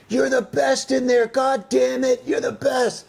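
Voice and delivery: high, hammed-up cowboy dad yelling from the fence; mock yell. A dad yelling at his son's Little League game, mock yell. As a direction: holler it through the chain link fence. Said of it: "yelling as I'm striking out".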